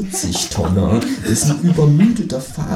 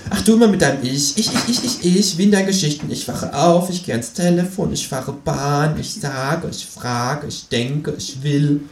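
high, fast, teasing kangaroo voice; mocking kangaroo; 6 semitones higher than usual. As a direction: rattle off every ich like a cheeky kangaroo. Kangaroo mocks the narrator.

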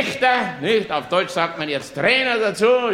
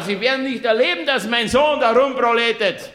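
ordinary delivery

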